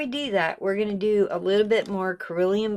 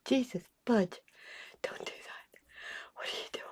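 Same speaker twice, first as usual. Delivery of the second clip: imitated viewer whisper; 2 semitones higher, 11 dB quieter than usual. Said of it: "you wanna just scream and go".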